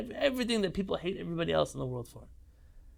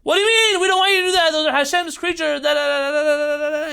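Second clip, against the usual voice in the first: mock angry children, much higher and louder; kids' tantrum; 11 semitones higher, 10 dB louder than usual. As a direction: yell like upset kids scolding their father. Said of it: "my sons threw a tantrum at me".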